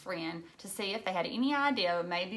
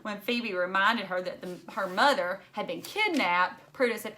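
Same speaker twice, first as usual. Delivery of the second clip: usual speaking voice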